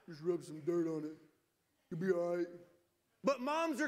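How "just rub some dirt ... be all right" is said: lower, dismissive dad voice; gruff dad. Retold or imitated. Dads telling hurt kids, lower brush-off voice. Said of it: "dads, man, we're like".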